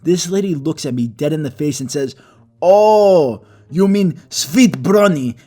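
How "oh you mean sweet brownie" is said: put-on slow, high, Greek-accented "sweet brownie" with a hard ending. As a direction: mimic a Greek cashier saying "sweet brownie". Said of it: "weird how she pronounced it".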